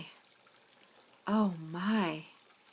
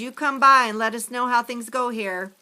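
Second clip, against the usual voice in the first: louder, higher parting words; hearty farewell; 4 semitones higher, 7 dB louder than usual. A narrator's reading. Grammy to the firefighter, a louder farewell. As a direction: send him off warmly but loud.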